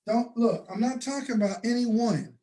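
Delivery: normal talking voice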